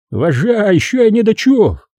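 high-pitched, scoffing commissar's reply; snorting retort. A narrator's reading. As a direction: snort it back, high and dismissive. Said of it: "фыркнул Тарас Иванович в ответ". (высоко, с фырканьем)

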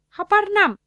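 high, loud shout of recognition; sudden delighted outburst; surprised cry. A narrator's reading. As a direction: blurt his name in astonishment. Patient recognises his friend.

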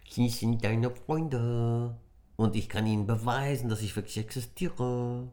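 high, playful tiny voice; high squeaky little creature urging on. Acted. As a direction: coax him along like a small cheeky sprite.

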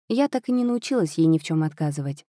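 usual speaking voice

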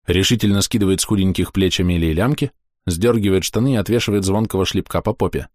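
ordinary delivery

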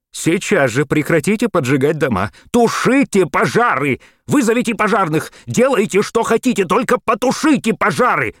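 high, loud frantic commands; yelling orders. A narrator's reading. Panicked mayor yelling at Maxwell to stop the fires. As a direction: scream the orders down the radio. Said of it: "орал мэр".

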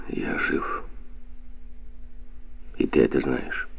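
soft, hollow, half-breathed answer; muffled reply. A narrator's reading. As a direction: answer low and muffled, almost without voice. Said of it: "глухо ответил Гвидо".

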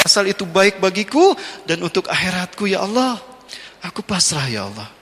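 humble prayer. Retold or imitated. Husband prays in kitchen.

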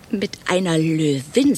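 slow, pointed quoted reply; drawn-out retort. Acted. Woman quoting a bloodied colleague to her friend, slow and pointed. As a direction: stretch out the correction. Retelling the colleague's bitter answer.

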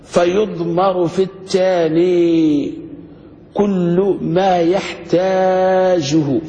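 reading the rule out very slowly; slow text reading. Shown as a demonstration. Teacher reads the book's rule aloud to students, very slow. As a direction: quote the grammar book word by word. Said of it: "إعمال الأول واختاره الكوفيون".

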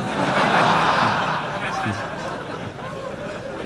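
a long airy laugh at his own joke; long breathy laugh. Real, not acted. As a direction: laugh breathily, letting it run out. (tertawa panjang berdesah)

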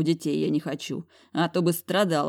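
usual speaking voice

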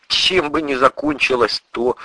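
usual speaking voice

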